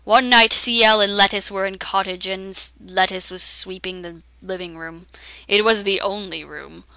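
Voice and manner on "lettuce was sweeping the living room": ordinary delivery, nothing marked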